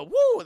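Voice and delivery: high squeaky woo. Acted. Bouncing chest sound effect.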